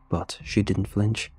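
usual speaking voice

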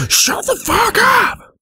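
very high, slow yelled scream; angry shout. Acted. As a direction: scream at them to shut up. Yelling at in-game mob.

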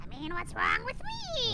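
slow, high cackly witch. Acted. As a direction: croak it like a little witch. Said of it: "your witch voice".

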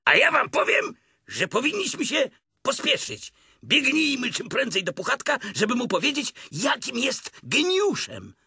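high, urgent, breathless-with-eagerness tiger; feverishly excited. A narrator's reading. Tigger hurrying friends to Pooh, high feverish excitement. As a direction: urge them on in a high voice. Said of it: "gorączkował się tygrys".

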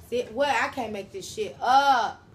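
can't believe what the cards show; stunned disbelief. Real, not acted. Tarot reader reacting to her own spread, stunned and loud.

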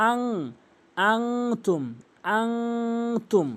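long nose hum in আং; correct ghunnah. Shown as a demonstration. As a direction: hum the nasal in আংতুম before tum. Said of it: "গুণনা করতে হবে".

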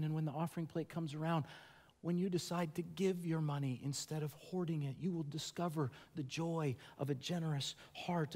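soft, intimate, earnest preaching; quiet sermon appeal. Real, not acted. A pastor urges the congregation to trust Jesus, softly and earnestly. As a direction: draw the congregation in close.

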